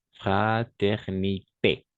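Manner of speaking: égalité stretched slowly with rising end; slow model word. Shown as a demonstration. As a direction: drag out égalité for the class. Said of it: "La E nuestra". (égalité lenta, de ejemplo)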